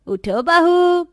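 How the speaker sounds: drawn-out loud call through the door; loud slow wake-up call. Acted. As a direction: call her awake, stretching बहू. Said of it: "इतना चिल्ला क्यों रही हैं आप".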